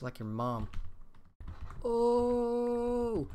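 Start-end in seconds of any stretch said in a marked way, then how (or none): 1.8-3.3 long, high, loud "oh"; mock burn "ooh". Gamer hyping his own mom joke, loud drawn-out jeer. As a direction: hold a high crowd-style jeer.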